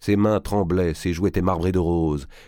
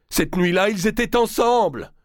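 much higher, cette nuit-là rising into a cry; distraught stammer; 4 dB louder than usual. A narrator's reading. Prince blurts his wife's betrayal, a high distraught cry. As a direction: voice climbing out of control. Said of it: "bredouilla-t-il".

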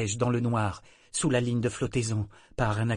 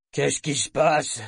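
tense voice; 4 semitones higher, 5 dB louder, slower than usual. A narrator's reading. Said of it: "demanda-t-il d'une voix tendue".

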